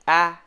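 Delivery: "A" as the vowel in fan; an isolated "aa", not "ay"; short A sound. Shown as a demonstration. Teacher reviewing short A, isolated vowel as in fan. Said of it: "the sound is".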